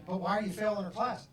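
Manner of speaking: lightly acted parental confrontation; exasperated parent. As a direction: demand an explanation like an exasperated parent. Parent questioning child in retold exchange.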